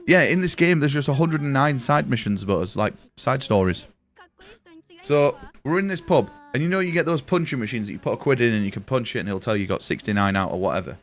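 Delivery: ordinary talk, nothing marked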